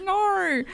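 "No" high and stretched into "naur"; "No" as a mocking Aussie "naur"; exaggerated Australian R. Shown as a demonstration. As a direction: pile an R onto the end of "No". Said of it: "We do love to add them in there".

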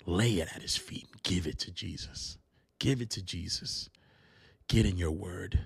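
soft, low, prayerful voice; hushed prayer. Acted. Preacher closing in prayer for listeners, hushed and soft. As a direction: bring it way down, like praying.

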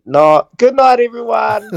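high, shouted farewell; loud sign-off. Real, not acted. Guest signs off to listeners, high exuberant shout. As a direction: call it out to the whole audience. Signing off from the show.

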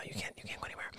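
dropped to a near whisper; hushed mock taunt; taunting undertone. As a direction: mutter it under your breath, teasing. Taunting the jailed man.

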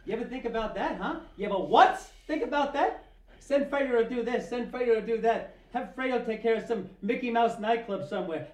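Fredo's outburst. Acted. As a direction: let the resentment boil up at his brother. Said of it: "the whole boat scene from The Godfather".

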